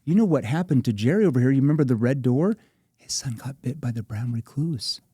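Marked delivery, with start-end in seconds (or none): 0.0-2.6 louder, higher, pressing; challenging sales pressure. Shown as a demonstration. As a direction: push them with urgency. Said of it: "That's a challenging tone".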